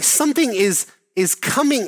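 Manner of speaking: usual speaking voice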